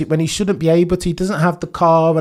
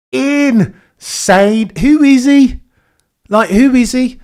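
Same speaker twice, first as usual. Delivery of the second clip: higher and louder, stretched out in disbelief; awed excitement; 8 semitones higher, 6 dB louder, much slower than usual. Real, not acted. Streamer to chat after Verstappen's pole, awed excited cry. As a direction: marvel out loud at the driver.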